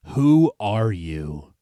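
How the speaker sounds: slow low demonic question; gruff voice. A narrator's reading. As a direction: growl it out slowly. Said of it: "in a gruff voice".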